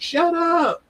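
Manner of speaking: high, sudden pretend shout; jokey shout at the birds outside; mock yell at birds. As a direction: snap it up high at the noisy birds. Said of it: "just yelling at a bird".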